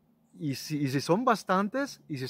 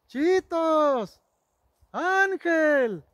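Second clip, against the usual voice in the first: loud high call of a nickname; 10 semitones higher, 5 dB louder, much slower than usual. Retold or imitated. Sister looking for her brother, loud high calling. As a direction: call out to find him in the crowd.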